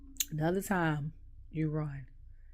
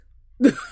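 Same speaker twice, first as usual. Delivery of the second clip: short burst of laughter, much higher and louder; 8 semitones higher, 14 dB louder than usual. Real, not acted. Vlogger laughing while retelling the match, loud. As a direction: crack up laughing.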